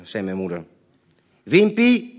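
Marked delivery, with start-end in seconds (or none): 1.4-2.1 loud, high call of a name. Retold or imitated. Father calls his son into the room, loud and high. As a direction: shout the boy in from the next room. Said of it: "riep de man".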